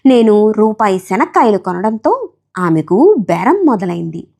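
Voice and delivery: ordinary delivery, nothing marked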